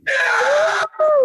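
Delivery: high shrill mock scream; imitated distant yell. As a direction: scream like a far-off voice. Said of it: "someone yelling in the background going".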